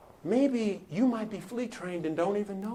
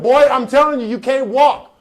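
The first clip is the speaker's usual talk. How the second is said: loud, raised insistence of a fed-up mother; mama scolding; 6 semitones higher, 13 dB louder than usual. Retold or imitated. Mama keeps telling Johnny no, loud scolding. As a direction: hammer it home to the boy.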